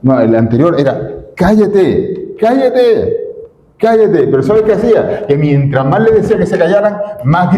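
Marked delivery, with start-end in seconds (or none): 1.3-4.3 sharp, rising shushing, higher each time; hushing rebuke. Acted. Crowd rebukes the blind beggar, irritated hushing. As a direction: scold him to shut up.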